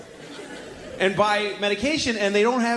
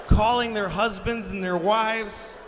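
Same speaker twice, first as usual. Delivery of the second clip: normal talking voice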